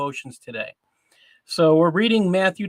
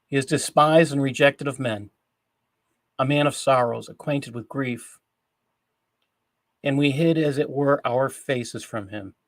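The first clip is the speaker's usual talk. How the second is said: solemn scripture reading; 3 semitones lower than usual. Acted. Host reads of the man of sorrows, solemn scripture reading. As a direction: read the prophecy gravely, phrase by phrase. Reads Isaiah fifty-three aloud.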